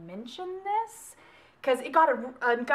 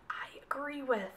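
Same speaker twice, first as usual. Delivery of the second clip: whisper; 10 dB quieter than usual. Real, not acted. Quiet aside after a dark quote.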